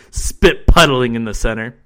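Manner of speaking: ordinary delivery, nothing marked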